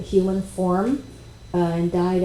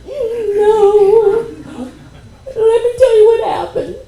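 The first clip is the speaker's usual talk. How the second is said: much higher and louder, laughing and crying at once; overwhelmed high cry; 9 dB louder, slower than usual. Retold or imitated. Telling the channeler what happened, overwhelmed laughing-crying cry. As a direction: blurt it out half laughing, half sobbing, voice soaring. Overwhelmed reply after the experience.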